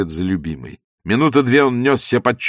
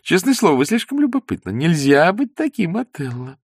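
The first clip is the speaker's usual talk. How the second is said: put-on higher, girlish playful voice; lightly voiced young woman; 8 semitones higher than usual. A narrator's reading. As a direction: Zosya teasing Ostap in a girlish voice. Zosya's voice.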